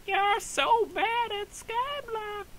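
very high, slow, whiny mock of a critic; mocking hater voice. Mocking imagined viewers who call him bad at the game, squeaky whine. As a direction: sneer it like a snotty commenter, stretched and squeaky. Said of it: "that's the voice I imagine".

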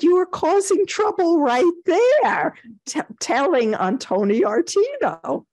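high-pitched, emphatic imitation of a friend setting someone straight; exasperated retort. Retells Wendy's exasperated answer.

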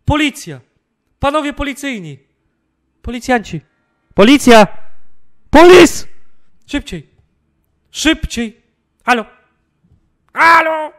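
frantic high shouts for help; panicked yelling. Real, not acted. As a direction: yell in total panic. Intruder inside, yelling for police.

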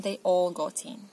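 plain reading voice

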